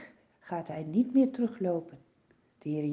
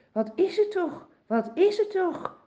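high, puzzled calling of the blind man; blind man asking; 8 semitones higher than usual. Acted. Blind beggar asking the crowd what is happening, high and eager. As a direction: ask eagerly, voice lifted. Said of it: "zegt hij".

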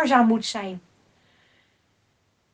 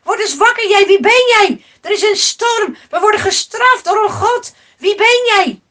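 high, loud demands at a sleeper; urgent shouting; 7 semitones higher, 7 dB louder than usual. A narrator's reading. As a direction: shout him awake in a storm. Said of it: "riep de man". (dringend, luid roepen)

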